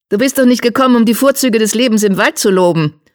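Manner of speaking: high, sharp interruption; Geißel. A narrator's reading. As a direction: cut in with a high, pointed voice. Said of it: "unterbrach ihn Geißel".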